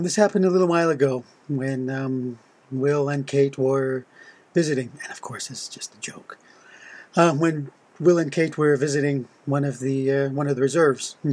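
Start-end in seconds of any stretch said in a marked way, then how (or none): 5.0-6.4 a quick whispered disclaimer; whispered aside. Real, not acted. Joke-teller's quick aside.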